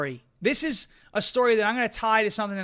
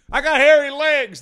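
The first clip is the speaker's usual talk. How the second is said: high, loud mock old-man blurt; Joe Biden impression; 6 semitones higher, 10 dB louder than usual. Host mocks a famous gaffe, blurted high and loud. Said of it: "when Joe Biden talks".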